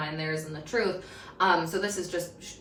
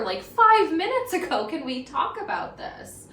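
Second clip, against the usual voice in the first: blindsided plea; 8 semitones higher, 5 dB louder than usual. Retold or imitated. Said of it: "So I'm like".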